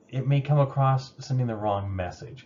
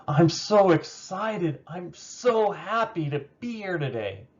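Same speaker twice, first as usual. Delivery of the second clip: much higher, slow and bright, leaning on "so"; genuinely excited; 8 semitones higher, slower than usual. Shown as a demonstration. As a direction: sound truly thrilled to be here. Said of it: "Same words, but the tone and inflection completely changed it".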